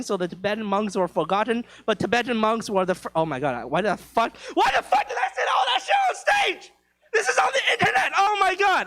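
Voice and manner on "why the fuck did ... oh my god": much higher, laughing outburst; real laughing yelp at himself; laughing shout. Real, not acted. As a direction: crack up in embarrassed disbelief. Embarrassed at saying it on stage.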